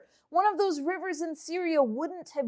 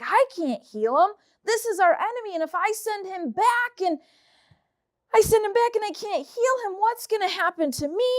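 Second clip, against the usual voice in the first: worried king; 6 semitones higher than usual. Acted. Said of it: "He's so worried".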